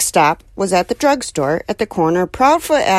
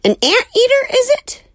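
baffled neighbor; 3 dB louder than usual. Acted. Neighbor mishears the word.

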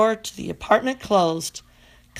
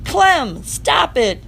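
loud, higher, firm command; firm shout; 7 semitones higher, 6 dB louder, much slower than usual. Acted. Miranda stopping her dog with a firm shout. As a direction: shout him down like a stern owner. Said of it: "she shouted in a very firm voice".

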